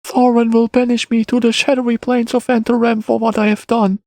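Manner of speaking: put-on high-pitched woeful boy; downbeat child. A narrator's reading. Boy fears banishment by Thorin, downbeat child voice. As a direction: lament your fate in a high child's voice. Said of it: "All at once it seemed rather downbeat".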